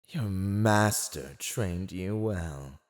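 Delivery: ordinary delivery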